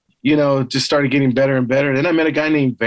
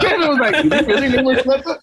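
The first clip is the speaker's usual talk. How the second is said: higher, quick, laughing through it; giggling while quoting a friend; 10 semitones higher, faster than usual. Real, not acted. Telling the hosts about Kevin's reaction, laughing.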